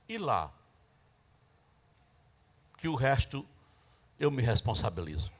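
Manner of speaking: low, soft divine voice; voice of the Spirit. Retold or imitated. Holy Spirit gently instructing the praying sister, low and soft. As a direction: speak it low and calm, as God.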